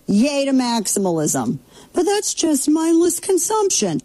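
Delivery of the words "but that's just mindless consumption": high, put-on scolding voice; mock communist critic. Mocking a critic of maximalism in a high scolding voice. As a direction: sneer it like a preachy critic. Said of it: "That's what a communist would say".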